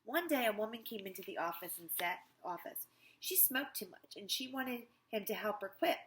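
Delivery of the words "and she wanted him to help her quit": ordinary delivery, nothing marked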